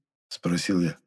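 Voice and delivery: ordinary delivery, nothing marked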